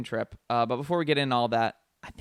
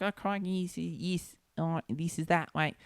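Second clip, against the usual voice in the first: higher, softer Cockney warm-up mumble; mock Cockney accent; 3 semitones higher, 6 dB quieter, slower than usual. Host showing how they focus for accents, in mock Cockney. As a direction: try out a silly Cockney accent under your breath. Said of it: "fucking Cockney accent".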